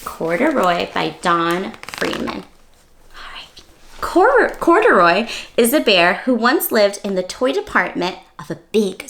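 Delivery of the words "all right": soft whispered aside. Real, not acted. Teacher settling in to read, whispered.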